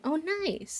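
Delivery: normal talking voice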